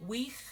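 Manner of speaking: "Weech" with a rough guttural ch at the end. Shown as a demonstration. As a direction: scrape the back of the throat on "Weech". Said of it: "make sure you do that guttural sound".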